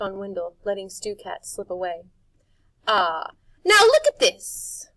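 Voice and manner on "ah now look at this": much higher, loud, slow chef's voice; a showy cook admiring his guest; Jive voice. A narrator's reading. Jive greets Harvey at the kitchen door, high and slow.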